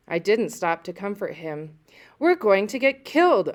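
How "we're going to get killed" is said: high, loud panicked cry; frightened cry. A narrator's reading. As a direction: cry out in fear while running. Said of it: "I cried".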